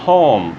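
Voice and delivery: Home with a breathy aspirated H; English pronunciation. Shown as a demonstration. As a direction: push air out on the H of Home. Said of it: "Esse é o som do H". (Home com H aspirado)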